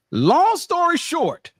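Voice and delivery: high, slow, stretched-out announcement; drawn-out exclamation. Acted. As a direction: stretch it out high to cut the story off. Cuts the argument short, calling out.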